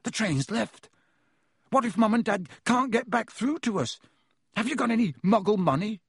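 high-pitched, stunned and worried. A narrator's reading. Stranded boy asks his friend about parents and money, stunned. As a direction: let the shock raise your pitch. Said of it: "sounding stunned".

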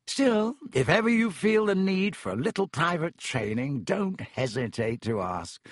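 patronise with grand self-importance; boastful professor. Acted. Vain professor offers Harry private training, condescendingly. Narrator acts out boastful Lockhart.

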